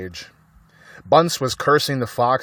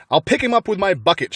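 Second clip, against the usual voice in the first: shouting; 8 semitones higher, 3 dB louder, faster than usual. A narrator's reading. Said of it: "shouted Bunce".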